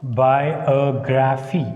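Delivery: "Biography" slow, split into even syllables. Shown as a demonstration. Teacher showing how to divide it, slow and segmented. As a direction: draw out "Biography" as bi-o-gra-phy. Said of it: "Like this".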